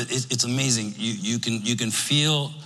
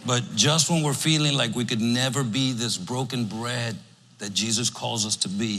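ordinary talk, nothing marked

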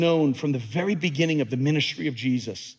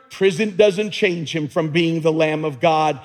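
normal talking voice

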